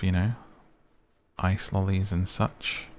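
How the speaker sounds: ordinary talk, nothing marked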